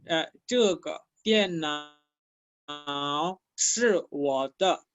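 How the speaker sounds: 这个电脑是我的 spoken slowly, syllable by syllable; walk them through the Chinese sentence slowly; slow model sentence. Shown as a demonstration. Teacher modeling a Chinese sentence for students, slowly. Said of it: "Si yo digo".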